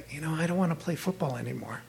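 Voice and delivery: small, hushed, hurried confession; sheepish. Retold or imitated. Son admitting to mom he wants to quit football, sheepish. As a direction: mumble it softly and quickly, like owning up. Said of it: "looked at her really sheepishly".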